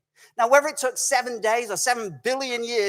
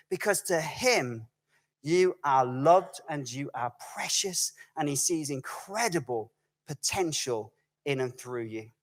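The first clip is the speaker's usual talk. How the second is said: tender reassurance; 8 semitones lower, 7 dB quieter than usual. Real, not acted. Tender close of sermon point.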